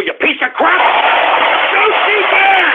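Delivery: high angry shouting; angry yelling. Real, not acted. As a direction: rage at the caller. Host rages at the skit.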